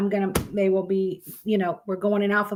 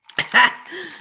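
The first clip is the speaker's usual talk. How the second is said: sheepish giggle; 8 semitones higher, 3 dB louder than usual. Real, not acted. Laughing at her drawings.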